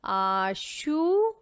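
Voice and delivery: very slow, stretched teaching read; slow blended word; drawn-out word. Shown as a demonstration. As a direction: stretch each syllable for the class. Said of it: "तो क्या बना?".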